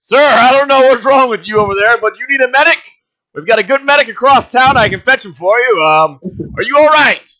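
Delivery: high, loud panicked barkeep; alarmed bartender. Acted. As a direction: shout up high in a fluster. Bartender NPC alarmed at owlbear noises.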